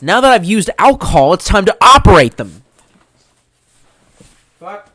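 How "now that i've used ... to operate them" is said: much louder, raised voice; loud hyped boast. Acted. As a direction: announce it like a battle cry. Drunkenly hyped about swords.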